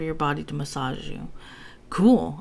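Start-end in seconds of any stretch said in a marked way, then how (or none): 1.9-2.4 earnest exclaim. A narrator's reading. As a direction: blurt it out, genuinely impressed. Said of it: "I exclaimed in earnest".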